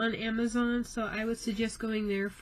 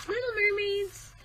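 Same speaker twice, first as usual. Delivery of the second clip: high cooing; 12 semitones higher than usual. Real, not acted. Cooing over mermaid picture.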